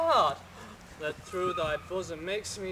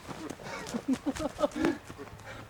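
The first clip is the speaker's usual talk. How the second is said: an unvoiced, airy laugh; a whispered chuckle; breathy laugh; 8 semitones higher, 3 dB quieter than usual. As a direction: laugh under your breath. Lysander laughing.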